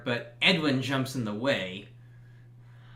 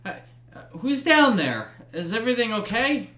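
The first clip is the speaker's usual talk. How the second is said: high, quick anxious call down the cellar stairs; nervous homeowner calling into the dark; worried voice upstairs; 9 semitones higher, 5 dB louder, faster than usual. Acted. GM voices a worried homeowner calling down the cellar, high and anxious.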